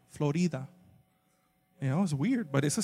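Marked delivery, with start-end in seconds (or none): 0.1-0.7 "Florida" said softly as Spanish "flo-REE-da"; soft Spanish "Florida", stressed on "ree"; Spanish pronunciation. Shown as a demonstration. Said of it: "imagine me pronouncing Florida correctly".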